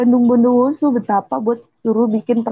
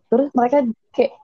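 ordinary talk

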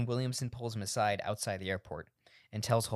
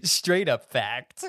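mock slogan; 3 semitones higher, 8 dB louder, much slower than usual.

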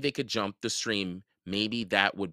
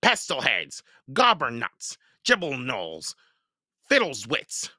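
higher goblin taunting; yelled insults; 5 semitones higher, 4 dB louder than usual. A narrator's reading. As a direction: rattle off the insults mockingly. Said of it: "he yelled at the other goblins".

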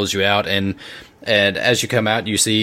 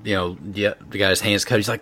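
ordinary talk, nothing marked

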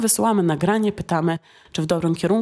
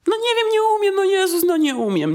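whining student; 11 semitones higher than usual. Retold or imitated. Hammed-up student complaining to the teacher that nothing helps. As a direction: whine like a stuck student. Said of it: "ćwiczyłam ... nie widzę poprawy".

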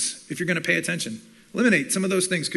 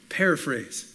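ordinary talk, nothing marked

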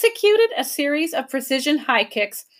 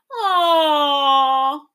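mock sad aw; 4 semitones higher, 4 dB louder than usual. A narrator's reading. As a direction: fake sad creature wail. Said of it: "pretended to be sad".

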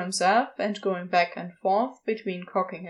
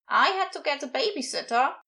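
higher, mildly put-out voice; complaining cultist; 5 semitones higher, faster than usual. A narrator's reading. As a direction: chime in with a higher-pitched grievance. Another cultist joins the complaint.

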